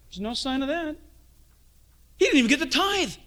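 lampoon the legalists, scandalized; sarcastic mimic of church folk; mock religious indignation. Mocking religious objections.

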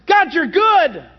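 re-enacted burst of gratitude; exclaimed praise. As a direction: call it out to God, drawn out. Acting out sudden praise.

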